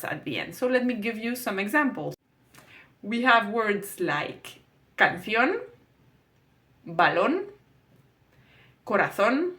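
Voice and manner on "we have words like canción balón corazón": canción, balón, corazón, each with stress on the final syllable, slowly with pauses; slow Spanish word list; end-stressed Spanish words. Shown as a demonstration. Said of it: "They all have that accent at the end".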